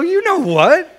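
high, loud jealous retort; mock exasperated comeback. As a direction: throw it back, hammed-up. Acted quarrel with fiancée.

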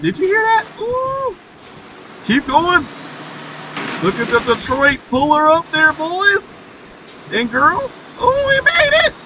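high-pitched, keyed-up chatter while driving; excited squeal. Real, not acted. Streamer crossing dirt to reach fuel, squealing high with excitement. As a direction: squeak it out, nervous and thrilled to make it. Tense drive across the dirt.

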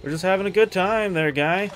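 ordinary talk

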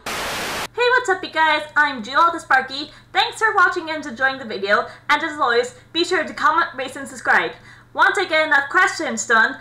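loud, upbeat sign-off read; YouTube outro. Acted. Host thanking viewers and asking for comments, loud upbeat outro. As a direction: pump up the viewers for the goodbye.

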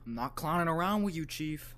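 shouted punchline at the clown; loud taunt. Real, not acted. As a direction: yell the joke at him.

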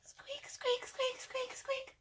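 tiny, very high, soft squeaks; mouse squeak. Shown as a demonstration. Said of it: "squeak like a mouse".

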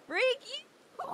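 high, loud playful cursing; mock-angry yell. Streamer cursing out viewer Yahoo, mock-angry yell.

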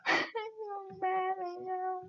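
mock mad, laughing through it. Real, not acted. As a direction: say you're mad but keep laughing. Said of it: "because I'm laughing".

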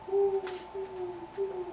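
high, sustained hooting; owl hoot. Acted. Old owl in the night, hooting. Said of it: "an old hoot owl hooted".

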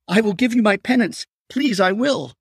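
high, frantic promise; desperate pleading. Acted. Patricia promising penance, desperate plea. Captive bargaining for her life.

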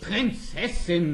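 ordinary talk

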